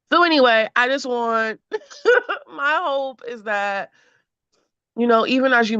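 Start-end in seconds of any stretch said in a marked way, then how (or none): 2.0-2.5 high laugh. Real, not acted. Laughs while wrapping up.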